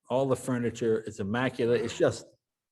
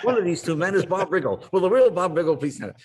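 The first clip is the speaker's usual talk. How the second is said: higher, louder, fast showman announcement; mock announcer call; 7 semitones higher, 6 dB louder than usual. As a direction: belt it out like an emcee working the crowd. Announcer calls out at the event.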